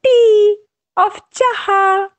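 high, drawn-out final words; playful story ending. A narrator's reading. Storyteller ending with the old lady's treat, high and playful. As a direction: lift the last words up and stretch them.

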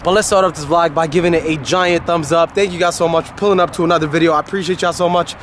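ordinary delivery

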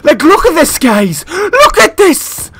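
very loud, high-pitched rant; furious yelling. Real, not acted. Gamer out of bullets against lucky player, furious yelling. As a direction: shout in outrage at bad luck. Rages after running out of bullets.

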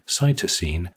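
usual speaking voice

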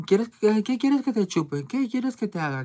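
high, slow, strange pleading tone; odd pleading voice. Retold or imitated. Old man asks her oddly, in a high pleading voice. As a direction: wheedle oddly like a creepy old client. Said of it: "así como muy raro".

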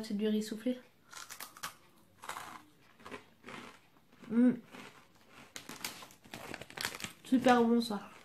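chewing while talking; talking between bites; mouth full. Real, not acted. Seen 1: Bites and chews cracker from hand while talking, mouth full.

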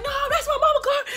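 laughing high-pitched sibling impression; imitating her brothers. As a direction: squeal it like upset little brothers. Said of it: "They're like".

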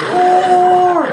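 high, loud drawn-out roar; elephant roar. Acted. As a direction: roar the word like a beast.

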